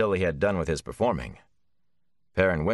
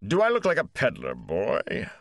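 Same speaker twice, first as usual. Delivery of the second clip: indignant, pitched high then dropping; grumbling; 5 semitones higher than usual. A narrator's reading. Gleeman grumbles at Mat's question, indignant. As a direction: scoff at being taken for a peddler. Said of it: "the Gleeman grumbled".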